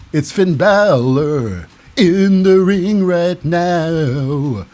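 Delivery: drawn-out announcer call, stretching the last words; mock ring announcer. Host brings the wrestler to the ring, mock announcer call.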